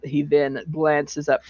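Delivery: ordinary talk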